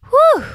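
high loud relieved whew; a big happy sigh of relief. A narrator's reading. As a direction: let out a big breath of relief. Relief after reaching base.

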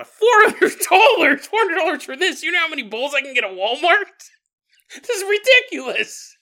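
high, loud shocked squeal at the price; shocked outrage. Retold or imitated. At the gallery, seeing a bowl's price tag, shocked high yell. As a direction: blurt it out in disbelief, way up high. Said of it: "And then you go".

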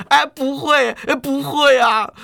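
hasty groveling. A narrator's reading. Swindler hurriedly kowtows to the angry hero, whimpering high. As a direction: cringe and agree at once. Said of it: "大仙连忙磕头".

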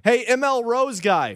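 high, drawn-out hail; stretched attention-getting call; mock calling out. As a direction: holler for restaurant staff, not full volume. Acting a customer calling staff.

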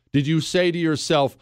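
ordinary delivery

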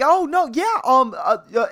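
mock awkward, faltering with fillers. Flustered customer backpedals about his mustache, awkwardly. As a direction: imitate a flustered, awkward stammer. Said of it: "like, she's super awkward".

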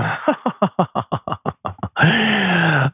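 high, trilling diva laugh; staccato giggle soaring into a sung sigh; aunt's theatrical laugh. Retold or imitated. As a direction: imitate a grand opera singer's long laugh. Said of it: "how she would laugh".

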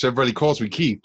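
usual speaking voice

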